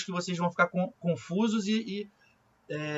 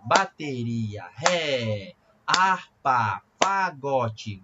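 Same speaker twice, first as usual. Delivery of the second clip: slow, lower chant, one word per beat; syllables in rhythm; 4 semitones lower, much slower than usual. Shown as a demonstration. Music teacher demonstrating the invented notation, syllables chanted in rhythm. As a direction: split each word's syllables evenly inside a steady pulse. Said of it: "Vai ficar mais ou menos assim".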